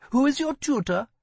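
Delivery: put-on a curious young woman asks; girl's voice. A narrator's reading. Elissa asks.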